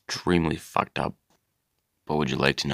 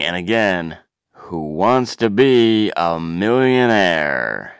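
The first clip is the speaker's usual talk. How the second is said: game show sign-off; 5 dB louder than usual. Acted. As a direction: boom out the show's farewell. Host signs off the show.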